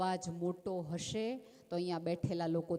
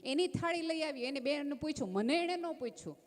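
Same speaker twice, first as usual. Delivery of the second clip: higher, aggrieved mock whine of a slighted sister-in-law; offended sister-in-law; 7 semitones higher than usual. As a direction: imitate her petty hurt complaint, pitched up. (ઊંચા, રિસાયેલા અવાજે જેઠાણીની નકલ)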